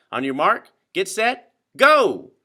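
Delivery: building from calm to a loud, high "go!"; race start call. Acted. Referee starting the boat race, rising to a shout.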